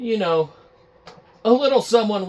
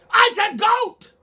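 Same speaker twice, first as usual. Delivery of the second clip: much higher, slow, loud insistence; insistent Phil Spencer; 6 dB louder, slower than usual. Acted. Phil Spencer insisting on goat to the employee, high and loud. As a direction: snap it back, losing patience. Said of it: "We're gonna do a little reenactment".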